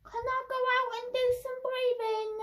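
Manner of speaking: put-on high, fast pleading kid; whiny kid. Whiny kid begging the teacher, high and fast. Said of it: "whiny one".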